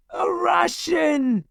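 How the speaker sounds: high, sharp alarmed shout. A narrator's reading. French soldiers spotting a Russian uniform, shouting high. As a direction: soldiers cry out at the sight of him. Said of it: "they shouted".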